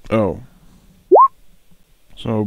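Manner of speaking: ordinary talk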